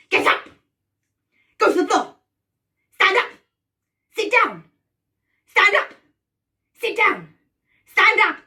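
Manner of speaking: high, loud, short commands; bossy witch. Pretend. Witch orders players up and down, loud commands. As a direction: fire off orders like a drill-happy witch. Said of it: "I'm going to order you around".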